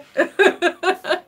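a burst of high, loud laughter. Real, not acted. Laughs at the viewer's joke.

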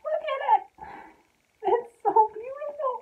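high, adoring squeal; overwhelmed gushing. Real, not acted. Overjoyed, gushing over the shirt.

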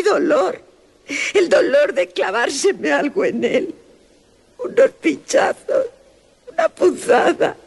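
voz aguda de niña, lenta y temerosa; voz de niña asustada. Acted. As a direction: una niña pequeña que susurra su miedo. Niña aterrada recuerda la visita. (niña aterrada que cuenta su pesadilla, aguda y lenta)

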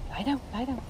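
soft command. Real, not acted.

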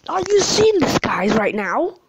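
loud shocked call to viewers; excited disbelief. Real, not acted. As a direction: grab the chat's attention.

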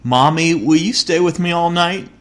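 put-on scared little boy. A narrator's reading. Little boy begging his mother during the thunderstorm, mildly scared. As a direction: play the frightened son begging mom to stay. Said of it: "asked her in a trembling voice".

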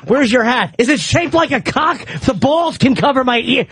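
hammed-up excited questioning; mock eagerness. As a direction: play an over-eager man fixated on one thing.

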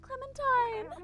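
loud, high, drawn-out cry of the name; anguished call. Real, not acted. Player to game character Clementine, anguished shout. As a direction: call out after someone leaving.

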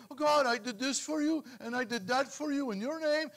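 high-pitched plea to God; self-justifying believer. Acted. Believer lists good deeds before God, high mock pleading. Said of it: "you're gonna stand there and go".

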